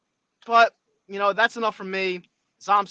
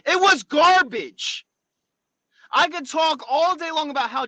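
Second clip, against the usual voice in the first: furious shouting at the coach, much louder; heated rant; 5 semitones higher, 8 dB louder than usual. Real, not acted. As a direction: let the anger boil over into yelling. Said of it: "I know I'm getting heated here".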